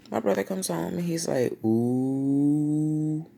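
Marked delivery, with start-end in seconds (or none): 1.6-3.2 long drawn-out teasing "ooh"; sing-song mock alarm; taunting sibling. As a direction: imitate a brother gloating at trouble. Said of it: "That's what he did".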